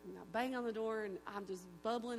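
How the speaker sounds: ordinary delivery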